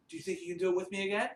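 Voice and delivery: usual speaking voice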